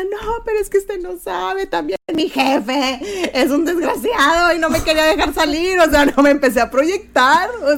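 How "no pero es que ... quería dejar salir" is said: high, wailing mock sob to the cop; fake crying plea. Faking tears to the traffic cop to get out of a fine. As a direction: ham up a teary excuse. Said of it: "ya estoy llorando".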